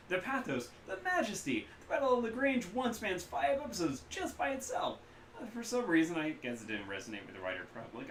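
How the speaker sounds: high, loud gushing fan voice; excited vendor. Acted. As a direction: gush about the show. Kaida gushing.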